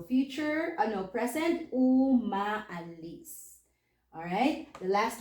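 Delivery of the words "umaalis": umaalis drawn out very slowly as "u, ma, alis"; slow teaching demo of umaalis; syllable by syllable. Shown as a demonstration. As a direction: stretch each part of umaalis. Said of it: "So we say um".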